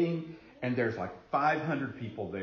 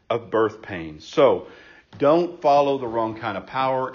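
loud, driving pulpit delivery; preaching at full force; 8 dB louder than usual. Acted. Pastor urging the congregation not to follow false power, loud preaching. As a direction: preach it out to the back row.

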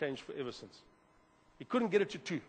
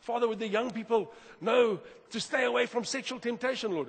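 higher, louder mock preaching prayer; hammed-up sermon disguised as a prayer; preachy prayer; 6 semitones higher, 3 dB louder than usual. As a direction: lecture the room while pretending to talk to God. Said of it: "you also get these preachy prayers".

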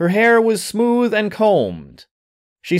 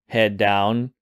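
ordinary delivery

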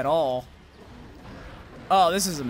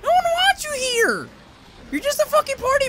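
mock falsetto taunt; 7 dB louder than usual. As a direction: taunt it in a silly high-pitched voice. Taunting the extra monster.

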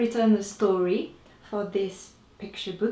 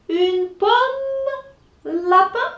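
much higher chirpy bird; 11 semitones higher, slower than usual. Acted. Bird offers rabbit an apple, high chirp. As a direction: pipe it like a small bird. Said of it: "and says".

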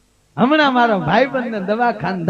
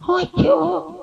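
nosy visitor; 7 semitones higher, slower than usual. Retold or imitated. Visiting women asking patient.

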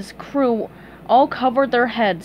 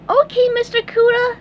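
very high, bright cartoon reply; happy and trusting; chirpy squeaky fry cook; cheery sponge; 13 semitones higher, 5 dB louder than usual. A narrator's reading. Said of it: "SpongeBob replied cheerily".

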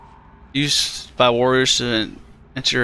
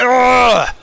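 loud, high exasperated ugh; sharp fed-up groan; frustrated groan; 12 semitones higher, 6 dB louder than usual. Real, not acted. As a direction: groan out your annoyance. Frustrated at a locked item.

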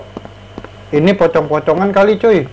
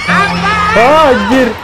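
startled scream, high and loud; scared horror game streamer; 12 semitones higher, 3 dB louder, much slower than usual. Real, not acted. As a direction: yelp in fright at a jump scare.

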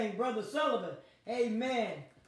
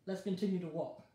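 low, soft closing words; gentle farewell; 6 semitones lower, 6 dB quieter than usual. Real, not acted. Preacher signing off, gently thanking her viewers. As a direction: send them off gently.

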